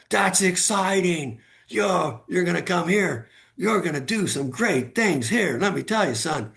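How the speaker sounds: high, slow, gushing boss voice; mock enthusiastic praise. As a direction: ham up the boss's excitement. Retold boss's enthusiastic praise.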